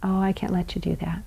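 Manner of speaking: calm whispered limit. Shown as a demonstration. Parent stopping a hitting child, whispered. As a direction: whisper it, quick and gentle. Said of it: "and you say".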